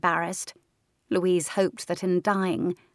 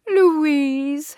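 high, loud cooing ghost call; ghostly coo; 9 semitones higher, 8 dB louder than usual. Acted. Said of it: "her ghost cooed".

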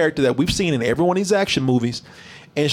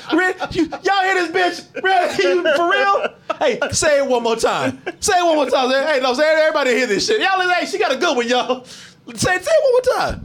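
hollering at the room to hear her line again; calling out the crowd; 10 semitones higher than usual. Retold or imitated. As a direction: yell to everyone in disbelief. Mocking disbelief, calling out crowd.

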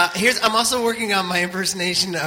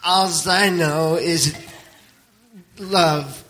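slow, mumbled, like peanut butter in the mouth; talking with peanut butter in the mouth, trailing off; Garrett impression; much slower than usual. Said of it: "my Garrett impersonation".

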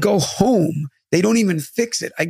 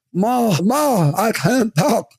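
slurred drugged voice; 3 semitones higher than usual. Retold or imitated. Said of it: "high off my face".

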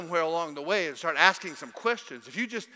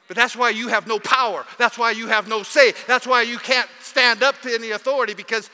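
louder, driving preacher's rebuke; preaching at full force; 7 dB louder than usual. Acted. Pastor rebuking the church, preaching at full force. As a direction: hit every line like a sermon climax.

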